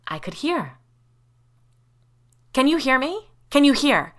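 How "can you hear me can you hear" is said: high, raised voice checking urgently; pressing, louder question; urgent calling. A narrator's reading. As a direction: call out to someone who may not hear. Anxious check that hearing returned.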